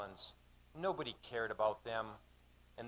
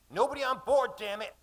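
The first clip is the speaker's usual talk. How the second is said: shouting; 12 semitones higher, 9 dB louder than usual. Acted. Captain warns off the crowding canoes.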